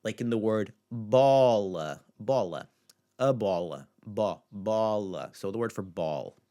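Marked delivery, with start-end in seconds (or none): 0.9-5.3 "bola" as "BAW-la"; slow model "bola" with open O; open stressed O. Shown as a demonstration. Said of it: "create the open stressed sound".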